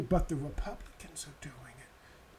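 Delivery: mocking whisper; whispered mock quote. Mocking her excuse in a whisper. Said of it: "when it comes to".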